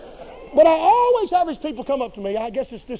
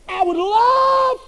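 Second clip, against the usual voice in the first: joyful cry; 10 semitones higher, 6 dB louder, much slower than usual. Acted. Preacher cries out in joy.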